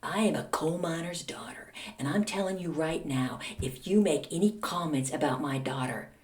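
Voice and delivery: hushed, half-whispered retold threat; quiet menace. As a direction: warn him softly, almost whispering. Mother's retold menacing warning.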